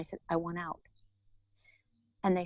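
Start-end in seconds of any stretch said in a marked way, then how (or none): 0.3-0.8 much softer retold plea; quiet surrender. Woman surrendering to the inner voice, quietly. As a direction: say it low, giving in. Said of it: "And I surrendered".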